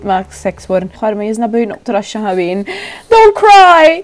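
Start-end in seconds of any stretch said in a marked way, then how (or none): 3.1-4.0 loud, high don't cry to a Sim; playful loud comfort; mock comforting shout. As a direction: call out to the sad Sim, hammed-up.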